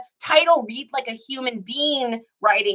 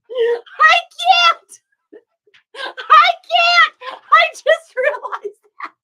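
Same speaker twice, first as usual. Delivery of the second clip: high-pitched, loud, disbelieving outburst; shocked disbelief; 8 dB louder, much slower than usual. Real, not acted. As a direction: cry out in shock at her own mistake. Laughing at her own old title.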